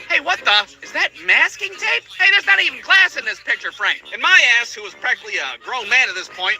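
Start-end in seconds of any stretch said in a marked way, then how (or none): 0.1-4.0 mom's surprised higher voice. Retold or imitated. Mom to her son, noticing the taped frame, surprised higher voice. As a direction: mom discovering the broken frame. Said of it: "my mom was walking through, like".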